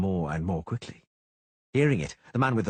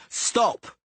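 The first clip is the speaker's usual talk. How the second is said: high, louder yelled stop; 5 dB louder than usual. A narrator's reading. Cicada yelling at Geralt to stop, high and loud. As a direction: bark it to halt him. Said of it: "Cicada yelled".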